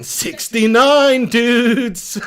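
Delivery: high, loud, drawn-out holler; goofy catchphrase shout. Acted. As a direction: belt it out slowly as a silly catchphrase. Shouted catchphrase, then laughs at himself.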